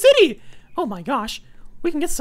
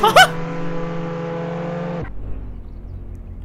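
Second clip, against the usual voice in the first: villain laugh; 12 semitones lower than usual. Pretend. Villain laugh after taunt.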